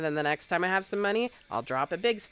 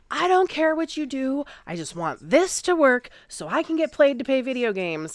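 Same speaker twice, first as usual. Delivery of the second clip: angry; 9 semitones higher, 8 dB louder than usual. Retold or imitated. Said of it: "One day I got mad".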